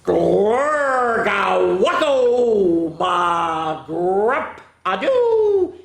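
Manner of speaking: very slow loud nonsense chant; slow booming gibberish; gibberish shout. Acted. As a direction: belt out the nonsense.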